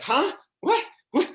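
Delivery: high, loud, short startled questions. Retold or imitated. Surfer reacting to a passing guy's remark, startled. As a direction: act out being caught off guard, pitch jumping up. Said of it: "I'm so startled".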